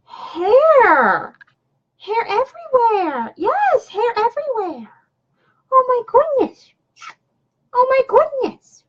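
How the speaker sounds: very high, squeaky-pitched exclaiming; high pet-talk. Real, not acted. Owner over dog hair everywhere, high pet-talk.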